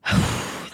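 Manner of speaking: long, voiceless exhale; heavy sigh. Real, not acted. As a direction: let out a big breath after a heavy story. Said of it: "That story got heavy for me".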